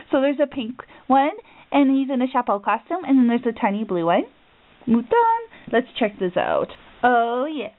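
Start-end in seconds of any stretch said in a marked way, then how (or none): none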